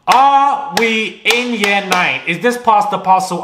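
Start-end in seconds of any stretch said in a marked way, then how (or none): 0.1-2.2 "Are we in year nine" drawn out slowly and higher; slow, indignant repeat. Real, not acted. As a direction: stretch every word of "Are we in year nine" in disbelief. Repeats mocking question slowly.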